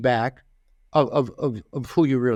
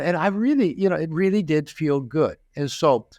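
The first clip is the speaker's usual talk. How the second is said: usual speaking voice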